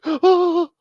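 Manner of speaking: high mock gasp of shock; gasp, hand over mouth. Daughter seeing the shooting, mock shocked gasp. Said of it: "She puts her hand up to her mouth like".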